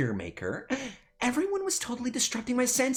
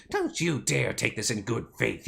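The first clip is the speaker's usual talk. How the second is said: normal talking voice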